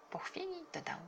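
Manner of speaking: ordinary delivery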